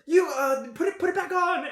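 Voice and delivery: high, stammering acted panic; panicking cleric. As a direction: fumble for a fix in a panicked high voice. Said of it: "The cleric is panicking".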